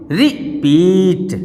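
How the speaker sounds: "Repeat" very slowly, as रि, then a long पीट; split into syllables. Shown as a demonstration. Teacher counting syllables, "repeat" slowly split in two. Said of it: "कितने syllable हैं आपके".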